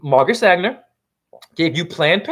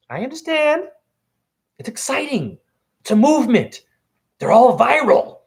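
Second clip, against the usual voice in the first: much higher, airy parody of how they talk; mocking their voice; 7 semitones higher than usual. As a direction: imitate them, sugary and higher. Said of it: "And they speak like this".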